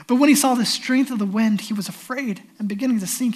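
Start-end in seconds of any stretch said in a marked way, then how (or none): none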